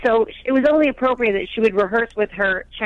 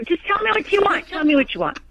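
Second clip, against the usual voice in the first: high-pitched mock pleading husband; pestering husband; 8 semitones higher than usual. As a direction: imitate a husband pressing for an answer. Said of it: "But he's like".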